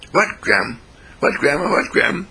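put-on high child voice climbing with each question; eager granddaughter. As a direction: pester grandma, pitch rising each time. Acted eager granddaughter asking grandma.

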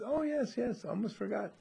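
a fish suddenly remembering, up high; a hint of a startled fish. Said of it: "the fish looks over and says".

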